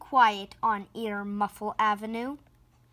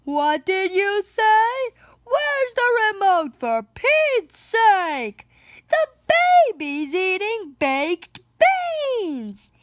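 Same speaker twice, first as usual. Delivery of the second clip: much higher, louder chaotic yelling; loud family yelling; 8 semitones higher, 5 dB louder than usual. Acted. The Louds shouting at each other at home, high loud yelling. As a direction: yell over all the noise at home.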